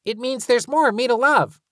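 put-on high, loud, happy kid voice; proud child voice. A narrator's reading.

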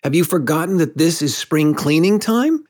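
a little stern. A narrator's reading. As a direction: chide lightly, voice climbing. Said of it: "he added a little sternly".

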